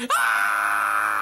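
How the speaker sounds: loud short scream; hammed-up dying yell; mock death scream. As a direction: scream as the chainsaw hits.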